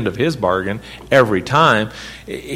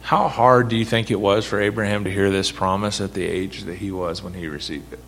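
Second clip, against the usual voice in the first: ordinary delivery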